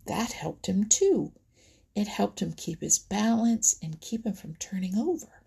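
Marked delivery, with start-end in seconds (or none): none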